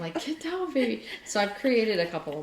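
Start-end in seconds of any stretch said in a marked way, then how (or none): 0.2-1.0 mock scolding the cat. Telling cat off TV, retold.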